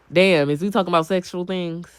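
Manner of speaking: usual speaking voice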